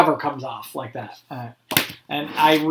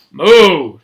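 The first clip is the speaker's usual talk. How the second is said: cow moo; 7 dB louder than usual. A narrator's reading. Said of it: "said the cow".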